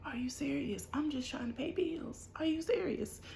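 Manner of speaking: hushed, rising whisper of disbelief; quiet incredulous whisper, voice climbing; disbelieving whisper. Retold or imitated. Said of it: "So I'm like".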